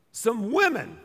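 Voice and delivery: women drawn out, stressed; preacher stressing that it was women; emphasis on women. Acted. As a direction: lean hard on women. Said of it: "let me emphasize this".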